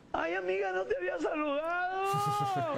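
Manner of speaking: high, slow put-on voice of a smooth flirt; flirty sweet-talker.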